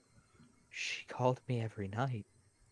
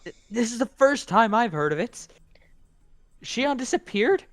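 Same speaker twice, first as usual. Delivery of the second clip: high, loud shocked outburst; shocked shouting; 12 semitones higher, 12 dB louder than usual. Acted.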